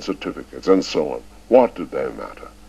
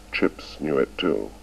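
usual speaking voice

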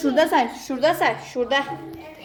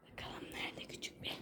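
whispering; 27 dB quieter than usual. Real, not acted. Hiding game, searching quietly.

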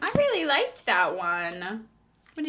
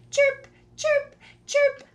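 high squeaky chick voice; baby birds squeaking; squeaked chirps; 13 semitones higher, 3 dB louder than usual. A narrator's reading. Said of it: "squeaked the chicks".

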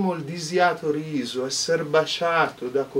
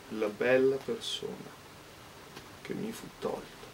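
Italian poetry recital; 5 dB quieter than usual. Acted. Poet recites Dante in the original, hushed and grave. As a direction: intone the old verse with reverence.

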